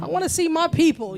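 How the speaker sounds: high, loud retold plea; playful imitation of insisting; insistent demand. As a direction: declare it like a star demanding his crowd. Father's demand, acted.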